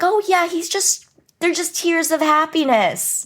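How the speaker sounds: high-pitched imitation of relatives covering up. Cousins covering for the crying to grandmother, high put-on reassurance. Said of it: "And they're like".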